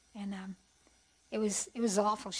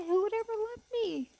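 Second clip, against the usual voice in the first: a high, pleading cry of the inner thought; 10 semitones higher than usual. Retold or imitated. Girl begging God not to let her mother die, pitched-up plea. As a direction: beg God, voice pitched right up. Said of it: "I remember thinking that".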